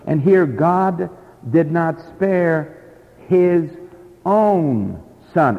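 slow emphatic reading, leaning on "own"; "own" lifted and held, each word weighted. Shown as a demonstration. Preacher to congregation, stressing "own" emphatically. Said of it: "the Greek is very emphatic".

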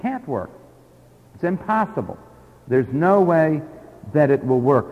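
normal talking voice

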